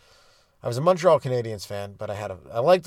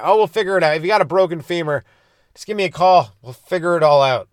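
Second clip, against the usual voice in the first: breezy driver; 4 semitones higher, 7 dB louder than usual. Retold or imitated. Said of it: "Is that guy".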